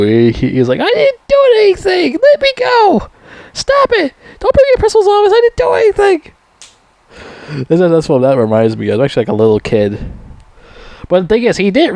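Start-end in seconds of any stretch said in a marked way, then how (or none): 0.8-6.3 put-on very high childish whine; middle school kid whine. Rambo likened to a middle-schooler, very high whine. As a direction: whine like a bratty little kid. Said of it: "like a little kid in, like, middle school".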